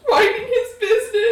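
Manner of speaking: squealed through laughter, high and loud; laughing. Real, not acted. Player breaking into laughter at the table. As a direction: crack up mid-line.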